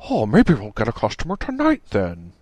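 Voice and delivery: sunny despite the bad news; inappropriately cheerful. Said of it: "cheerfully said, full of optimism".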